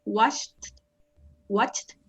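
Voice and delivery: "Washed, watched" each finished with a released T. Shown as a demonstration. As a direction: demonstrate the T ending of "Washed, watched", word by word. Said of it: "como una T al final".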